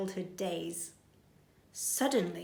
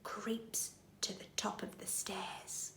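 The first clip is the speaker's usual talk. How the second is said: whispering; 15 dB quieter than usual. A narrator's reading. Narrating a creeping scene.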